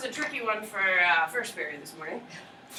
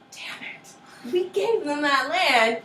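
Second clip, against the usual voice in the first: whispered curse, then a higher, slow complaint; mock frustrated British; 6 semitones higher, much slower than usual. Teacher voicing the British regretting the land they gave. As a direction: hiss the regret, then whine. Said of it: "They're like".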